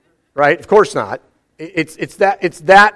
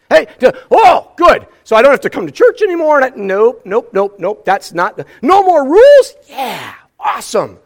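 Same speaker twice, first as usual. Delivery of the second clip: higher, louder, fast gleeful rush; mock glee; 5 semitones higher, 5 dB louder than usual. Churchgoer celebrating no more church rules, mock glee. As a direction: gleefully misread the verse as a free pass.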